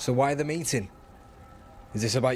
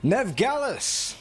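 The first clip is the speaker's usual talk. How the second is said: hailing by name; 10 semitones higher, slower than usual. Acted. Hailing a returning acquaintance.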